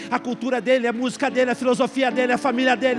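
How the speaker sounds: rising from firm to a full shout; preaching crescendo ending in a shout; building preacher shout. Real, not acted. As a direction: build each line till the last bursts out.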